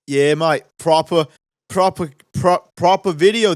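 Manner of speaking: put-on Australian accent. Pitching his Australian news videos to viewers, hammed-up Australian accent.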